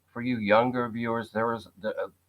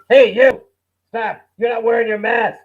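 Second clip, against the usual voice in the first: loud, high barking order; hammed-up scolding yell at someone without a mask; mock bossy shout; 10 semitones higher, 11 dB louder than usual. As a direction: bark it like a petty mask cop.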